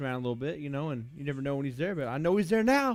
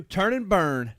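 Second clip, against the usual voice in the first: punched out louder; 7 dB louder, slower than usual. Acted. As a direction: call the slogan out with punch. Slogan shouted then echoed.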